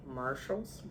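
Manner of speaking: soft, unsure "Marshalls?" with a rise; second-guessing the store name "Marshalls?"; doubtful question. Real, not acted. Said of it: "Listen to me".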